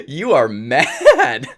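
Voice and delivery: loud, drawn-out words through laughter; laughing disbelief. Retold or imitated. Boyfriend marvelling at girlfriend's anger, loud and laughing. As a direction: stretch it slowly and loudly while laughing.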